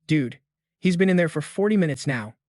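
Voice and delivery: plain reading voice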